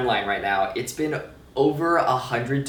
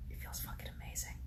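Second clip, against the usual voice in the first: hushed, excited whisper; 20 dB quieter, faster than usual. Real, not acted. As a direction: whisper it like a secret. Whispered delight about surgery.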